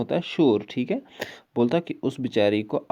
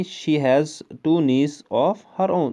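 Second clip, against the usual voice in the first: "She has two niece of her own" read very slowly and evenly; careful reread; 4 semitones higher, much slower than usual. Shown as a demonstration. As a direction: reread it cleanly, word by word. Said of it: "sorry". (धीरे-धीरे साफ़ पढ़ना)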